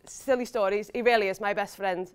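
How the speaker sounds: ordinary talk, nothing marked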